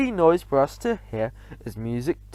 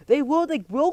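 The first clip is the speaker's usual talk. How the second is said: eager witches, pitched high; a hint of eager crying out; 10 semitones higher than usual. A narrator's reading. Witch audience cheering back at their leader. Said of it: "cried the audience".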